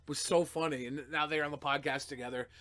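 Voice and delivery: ordinary talk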